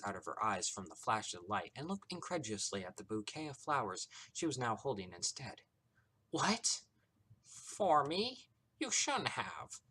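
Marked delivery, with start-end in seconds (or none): 6.3-9.8 high, gushing mock gratitude; over-the-top sweetness. A narrator's reading. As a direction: coo in exaggerated, teasing delight. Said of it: "snickering over-the-top sweetness".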